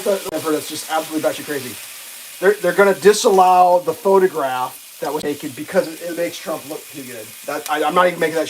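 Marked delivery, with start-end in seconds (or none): none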